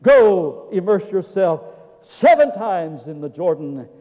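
loud, slow, drawn-out command; commanding preacher. Acted. Preacher relaying the prophet's order to bathe, commanding. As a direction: proclaim it with force, stretching the words.

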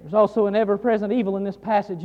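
ordinary delivery

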